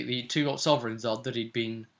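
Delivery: normal talking voice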